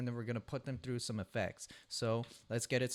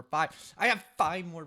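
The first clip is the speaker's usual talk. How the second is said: exasperated outburst; 6 semitones higher, 9 dB louder than usual. Real, not acted. Overwhelmed counting his backlog.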